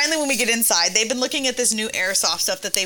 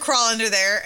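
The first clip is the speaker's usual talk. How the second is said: ordinary delivery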